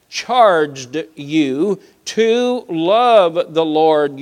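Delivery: slow, emphatic Bible reading; scripture reading. Real, not acted. Preacher reading the law to his congregation, slow solemn emphasis. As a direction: read it out deliberately, leaning on the key words.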